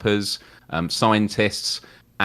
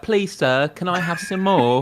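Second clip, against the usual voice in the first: higher, pleading little orphan voice saying "Please, sir"; Oliver Twist; 10 semitones higher, slower than usual. Retold or imitated. Guest quoting a classic, meek high orphan plea. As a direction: beg meekly like a hungry workhouse boy. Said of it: "like Oliver Twist".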